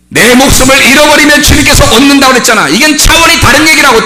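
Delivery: high, forceful pulpit cry; full-force preaching. Real, not acted. Preacher on losing one's life to gain it, full-force preaching. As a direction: drive the teaching home at a high pitch.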